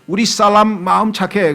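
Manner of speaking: 살람 said with a doubled l in place of 사람; Chinese-style mispronunciation. Retold or imitated. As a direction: imitate a Chinese speaker saying 살람. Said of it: "살람이라 그래". (중국식 발음 살람)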